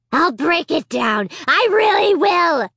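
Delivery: high, loud, slow puppet threat; threatening dummy. A narrator's reading. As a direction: threaten to smash through. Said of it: "he threatened".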